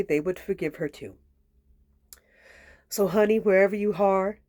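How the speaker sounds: ordinary talk, nothing marked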